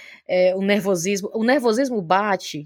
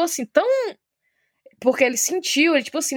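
ordinary talk, nothing marked

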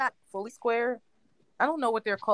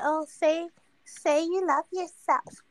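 mocking voice; 7 semitones higher, slower than usual. Mocking self-help people.

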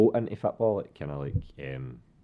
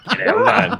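high, loud laughing exclamation; laughing protest; 15 dB louder than usual. Retold or imitated. Pushed player exclaiming high through laughter. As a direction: laugh it out in disbelief. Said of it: "I was like".

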